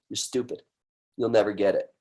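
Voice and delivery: low, quiet sneering inner voice; inner critic voice. Retold or imitated. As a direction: voice the nasty inner critic under your breath. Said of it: "it says this crazy stuff".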